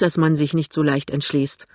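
ordinary delivery

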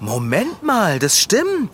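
bright realisation, rising high; light up happily at the idea; suddenly delighted, high-pitched; cheerful. Acted. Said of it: "Wieso sagst du das so fröhlich?".

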